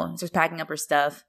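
ordinary delivery

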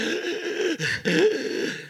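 imitating a child gasping for air, high; a big wheezy gasp like a kid who can't breathe; mock asthmatic gasp. Dad imitating his asthmatic son mid-attack, mock gasp. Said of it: "He's all like".

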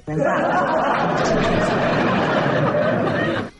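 breathy high giggle; laughing. Real, not acted. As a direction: burst out laughing. Laughing at sleep-talk recordings.

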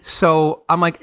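ordinary talk

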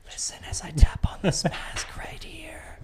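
whispered ASMR narration; playful ASMR whisper; mock ASMR. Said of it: "A S M R would go south".

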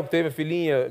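ordinary delivery

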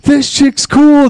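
hammed-up awed student; mock impressed kids. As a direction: gush like an impressed kid. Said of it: "They were like".

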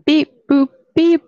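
high, chirpy beep boop noises; robot beeps. Pretend. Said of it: "this is Robot [name]".

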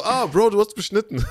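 high, loud exclamation; mock shocked discovery. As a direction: imitate a boy blurting out shocking news. Voices the boy reading the file. (hoch und laut, gespielt entsetzt)

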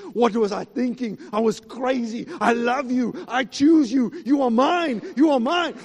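rising emotion. Retold or imitated. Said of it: "he looks and says".

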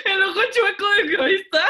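high, loud, fast laughing blurt; laughing hard. Real, not acted. Friend cracking up at the ghost story, fast laughing blurt. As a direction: crack up while saying it.

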